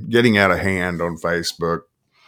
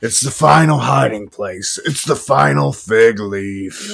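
theatrical film-quote voice, pitched up; movie monologue; 4 semitones higher than usual. Acted. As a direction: preach it like a movie devil. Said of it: "If you've never seen The Devil's Advocate".

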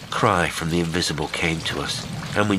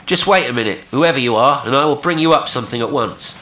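loud, high, urgent hail; raised voice pitched to carry; calling out; 7 semitones higher, 7 dB louder, faster than usual. A narrator's reading. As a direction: call out briskly over the rail. Will calls out to the stranger.

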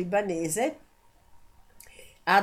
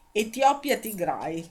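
usual speaking voice

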